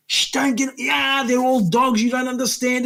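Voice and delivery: high, urgent shushing of a cellmate, slowed; half-acted plea to calm down, pitched high; hushing plea. Hushing the swearing inmate in van.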